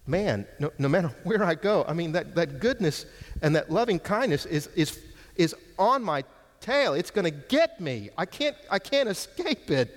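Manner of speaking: high-pitched, animated mock helplessness; humorous David. Preacher imagines David chased by goodness, humorous mock alarm. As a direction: play someone comically hounded, stumbling over the words. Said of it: "I think it's really humorous. I, I mean, I can see David".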